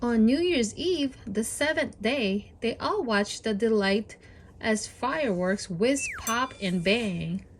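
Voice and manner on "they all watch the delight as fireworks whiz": ordinary delivery, nothing marked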